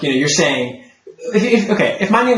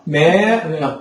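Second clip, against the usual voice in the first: ordinary delivery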